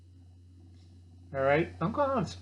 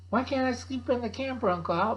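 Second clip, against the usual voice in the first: put-on higher pleading kid; 7 semitones higher than usual. Kid begs Uncle Al to sleep in the camper, higher voice. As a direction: beg like a child for the camper.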